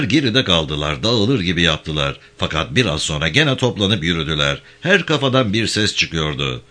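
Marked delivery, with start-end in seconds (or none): none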